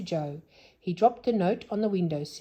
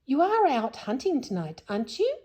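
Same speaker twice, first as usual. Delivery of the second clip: higher, coaxing sweet question; 8 semitones higher, 4 dB louder than usual. Acted. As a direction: coax him gently. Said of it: "in her sweetest voice".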